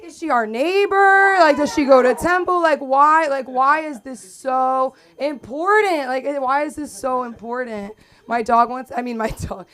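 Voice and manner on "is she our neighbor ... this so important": high, loud comic disbelief; exasperated questioning. Retold or imitated. Daughter grills dad over his pointless news, exasperated. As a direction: pile up baffled questions, stretching the key words.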